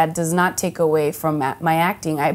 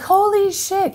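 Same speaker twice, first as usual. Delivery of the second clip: mock fan shock; 13 semitones higher, slower than usual. Fans realizing who she is.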